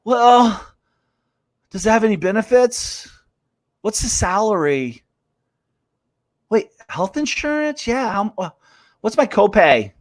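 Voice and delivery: lightly voiced, haltingly questioning job applicant; mock the doubters, pausing between each question; imitating someone fussing over terms; hesitant job-seeker. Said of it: "most of you out there".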